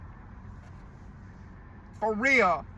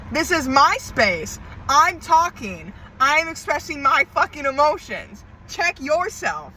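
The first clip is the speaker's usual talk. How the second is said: fierce pushback; 5 semitones higher, 3 dB louder than usual. Real, not acted. Pushing back at invading energy.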